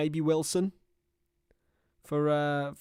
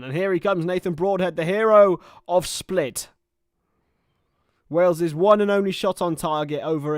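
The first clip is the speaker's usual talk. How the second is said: big rising hype, louder and higher, slowing on the last words; hyped announcement; 5 semitones higher, 9 dB louder than usual. Real, not acted. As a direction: announce him like a cup hero. Hyping the sub coming on.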